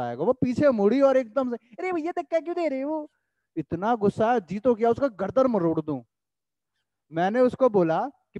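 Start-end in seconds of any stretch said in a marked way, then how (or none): 1.8-3.1 high-pitched indignant snap; mock the offended girl's shrill complaint, much higher; imitating annoyed girl. Teacher mimics girl scolding him on metro, high indignant voice. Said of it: "उसका reaction था".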